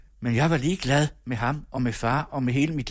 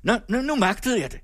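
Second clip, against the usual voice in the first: relieved exclamation; 10 semitones higher than usual. A narrator's reading. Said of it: "Han åndede op".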